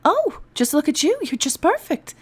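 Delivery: high cooing over a puppy. Acted. An elf cooing over a wax seal like a puppy. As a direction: coo at the seal adoringly. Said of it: "cooed as if she'd found a lost puppy".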